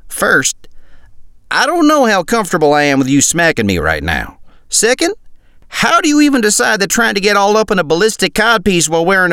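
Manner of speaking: high, loud incredulous voice; indignant soldier. Acted. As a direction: protest in disbelief. Voices Straight's complaint.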